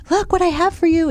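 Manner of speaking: high sing-song voice to a pet; excited high pet talk; talking to a dog. Retold or imitated. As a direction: coo it up high like showing a dog a treat. Woman shows dog the treat.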